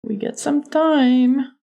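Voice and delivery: high, slow mock Texan; bad Texas accent. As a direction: pitch up and stretch out a bad Texan. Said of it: "Doing a really bad Texas accent".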